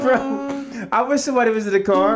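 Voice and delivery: normal talking voice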